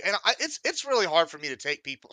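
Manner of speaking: normal talking voice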